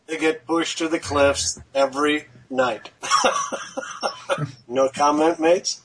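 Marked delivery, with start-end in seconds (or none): 3.0-4.6 high, airy burst of laughter; laughing. Real, not acted. Joke teller after the cliffs punchline, laughing. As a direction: laugh at your own bleak punchline.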